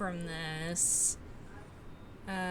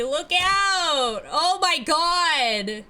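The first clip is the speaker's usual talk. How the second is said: high, loud panicked yell; shouting; 8 semitones higher, 8 dB louder, slower than usual. Real, not acted. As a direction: scream the warning. Panic as character is hit.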